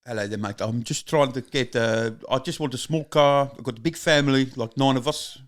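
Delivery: improvised South African customer character; South African accent. Acted. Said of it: "do a South African".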